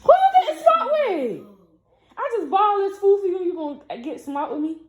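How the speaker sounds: high, sharp scolding big sister. Real, not acted. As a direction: tell him off for getting smart. Scolding little brother.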